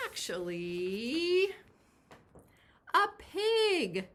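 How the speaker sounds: high, loud, drawn-out reveal of the surprise; delighted announcement; excited reveal. Real, not acted. Big reveal of the present.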